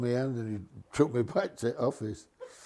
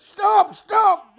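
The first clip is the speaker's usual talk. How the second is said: very high, loud yell of stop; alarmed passenger yelling at the driver to stop; shout; 10 dB louder than usual. Retold or imitated. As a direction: bellow it to halt the bus. Someone shouts from the bus.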